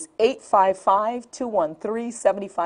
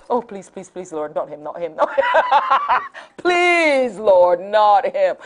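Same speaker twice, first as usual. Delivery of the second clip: mock pleading prayer; 4 dB louder than usual. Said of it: "I prayed to the Lord".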